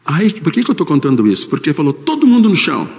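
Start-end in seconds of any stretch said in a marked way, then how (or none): 1.9-2.9 high barked command; robber's order. Retold or imitated. Robber ordering the restaurant floor, high shouted command. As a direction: yell it like a gunman taking the room.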